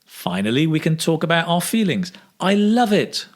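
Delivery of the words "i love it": "I love it" high on "love", then falling; expressive intonation. Shown as a demonstration. Teacher modelling an answer about feelings, "I love it" with high expressive intonation. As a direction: lift the pitch high through "I love it". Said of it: "Notice the intonation".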